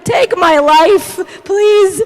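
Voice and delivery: hammed-up wailing prayer, loud and high; melodramatic plea. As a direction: mock-desperate cry to the Lord. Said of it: "And the drama".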